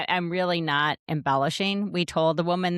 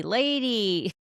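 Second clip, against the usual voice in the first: put-on Brooklyn accent; 4 semitones higher than usual. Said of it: "They have a Brooklyn accent".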